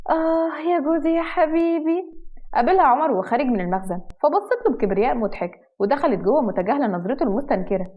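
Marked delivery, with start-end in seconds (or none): 0.1-2.2 slow, high-pitched dreamy swoon; lovestruck. A narrator's reading. Wife admires her husband from afar, lovestruck. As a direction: swoon it out slowly, high and sustained. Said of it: "تتكلم بهيام".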